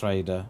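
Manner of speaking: Rhaegar said as RAY-der. Shown as a demonstration. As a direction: Rhaegar with stress on the first syllable. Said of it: "That's how it's pronounced".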